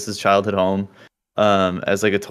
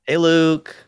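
bright high hey to Luke; playful high call to a neighbor on stream; playful greeting; 8 semitones higher, 4 dB louder than usual. Pretend. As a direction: say hi to Luke like a cheerful chat. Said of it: "Everyone say".

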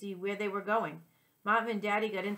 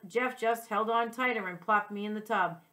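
usual speaking voice

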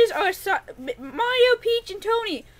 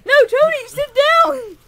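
higher, louder scolding; 6 semitones higher, 6 dB louder, slower than usual. Acted. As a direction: tell him off sharply. Scolding unruly Tony.

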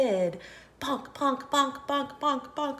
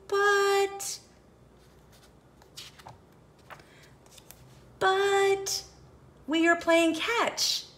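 high, lively storybook voices; character voices; 9 semitones higher, 5 dB louder, slower than usual. Acted. Reader voices Piggy and snake, high pitched. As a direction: play the friends high and bright.

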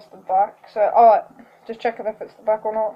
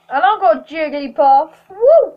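excited; 5 semitones higher, 7 dB louder than usual. Real, not acted. Pulling a good card, cheering.

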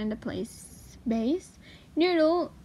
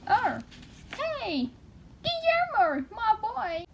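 much higher, delighted call to the pet; excited greeting; 9 semitones higher than usual. Real, not acted. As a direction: greet him like you're thrilled he's here.

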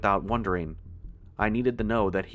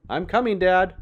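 loud, high shout; raised, urgent yell; calling out; 10 semitones higher, 7 dB louder than usual. A narrator's reading. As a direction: call ahead to someone far off. Son calls out to distant father.

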